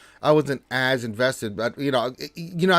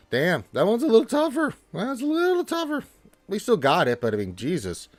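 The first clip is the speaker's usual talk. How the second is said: exasperated; 9 semitones higher, 7 dB louder than usual. Real, not acted. Rough fight on stream.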